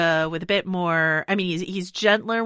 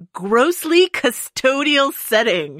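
slow, higher and louder, savoring each word; Nicole Kidman line reading; 7 semitones higher, 6 dB louder, much slower than usual. Retold or imitated. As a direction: relish the phrase like a prim, offended socialite. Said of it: "Nicole Kidman saying".

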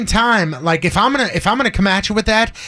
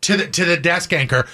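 ordinary talk

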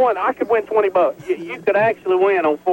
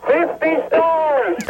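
bullhorn shout; 8 semitones higher, much slower than usual. Real, not acted. Shouting answers through bullhorn.

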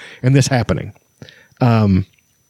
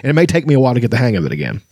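rushed; much faster than usual. Acted. Flint rushing on.

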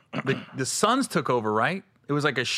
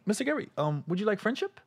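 higher, soft mock tout voice; pushy tout; 8 semitones higher, 5 dB quieter than usual. Bar tout offering women, higher and softer. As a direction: offer it sweetly like a hustler.

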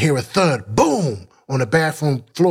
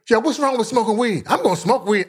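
higher-pitched retold challenge to the room; defiant; 6 semitones higher than usual. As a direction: challenge the whole meeting, pitched up. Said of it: "just be defiant to everybody".

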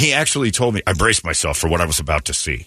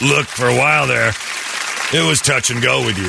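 dad voice; 4 semitones higher than usual. Retold or imitated. Dad quoted in story.

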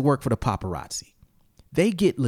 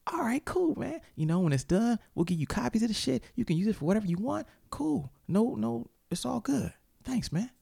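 mock friendly photographer; 8 semitones higher, 5 dB quieter than usual. Photographer voiced, high and soft.

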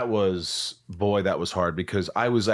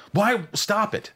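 high, urgent quizzing of yourself; sharp, higher questions to yourself; scolding self-talk; 6 semitones higher than usual. Retold or imitated. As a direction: snap yourself out of panic. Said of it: "I actually say this out loud".

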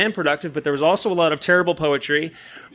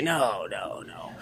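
low, soft, put-on voice, much lower; silly put-on voice; 10 semitones lower, 9 dB quieter, much slower than usual. Refusing friend's invitation in low sulky put-on voice. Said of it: "That was my voice".

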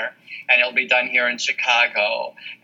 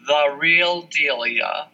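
spelling out slowly; much slower than usual. Shown as a demonstration. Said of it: "just the way it sounds".

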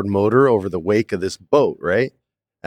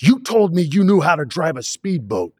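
accusing director; 8 semitones higher than usual. Retold or imitated.

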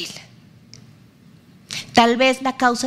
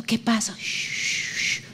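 soft hush, much softer, the shh breathed; calm the baby down gently; soothing parent; 2 semitones lower, 9 dB quieter, much slower than usual. Retold or imitated. Parent comforts a crying baby, soft soothing hush.

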